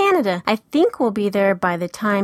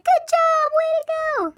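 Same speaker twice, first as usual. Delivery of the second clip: cheering. Retold or imitated. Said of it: "everybody was like".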